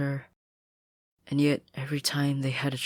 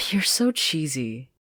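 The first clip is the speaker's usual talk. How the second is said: slow, teasing, a bit louder; teasing Anne; 4 semitones higher, 7 dB louder, slower than usual. Acted. Anne teasing Sasha, slow and playful. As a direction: tease her friend gently for being sappy. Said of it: "she heard Anne say".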